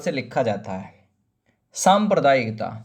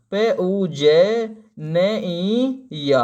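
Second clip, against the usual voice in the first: spelling out; much slower than usual. Shown as a demonstration. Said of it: "पूजनीय में जय आदा नहीं आता".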